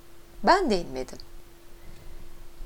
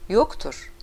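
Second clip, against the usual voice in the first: usual speaking voice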